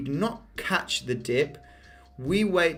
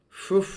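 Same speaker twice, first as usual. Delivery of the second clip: loud, high blown-out phew; a loud phew at the chart; sharp phew of relief; 6 semitones higher, 4 dB louder than usual. Real, not acted. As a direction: let out a big whistle of relief. Reacts to a massive uptrend.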